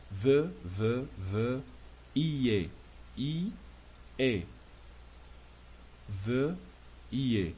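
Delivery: veuillez split into separate, repeated sounds; veuillez sounded out. Shown as a demonstration. Teacher drilling the pronunciation for learners, veuillez sounded out in pieces. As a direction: break veuillez into ve and i-e, pausing between. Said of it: "C'est la prononciation".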